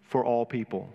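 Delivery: rising, ringing pulpit voice with lifted pitch; fervent preaching. Acted. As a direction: preach it out to the congregation, building fervour. Impassioned sermon opening.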